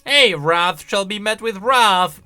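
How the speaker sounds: usual speaking voice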